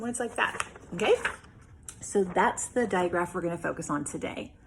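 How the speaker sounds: ordinary talk, nothing marked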